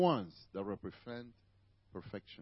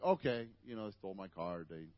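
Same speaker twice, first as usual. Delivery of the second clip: calm acceptance; 8 semitones lower, 6 dB quieter than usual. Retold or imitated. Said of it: "I'm like".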